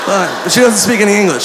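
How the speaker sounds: usual speaking voice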